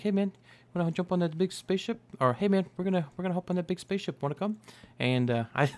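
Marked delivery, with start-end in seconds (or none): 0.0-2.0 Korg impression. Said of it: "he's like". 2.3-4.6 Korg impression. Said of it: "I've been trying to do the voice".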